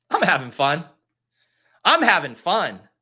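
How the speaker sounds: loud, mock-desperate insistence; forced fun insistence.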